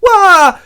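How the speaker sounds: very high, loud mock shriek; swooning shriek. Rosalind hearing of Orlando's wound, mock swoon shriek. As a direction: let out a hammed-up fainting squeal. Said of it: "And she swoons".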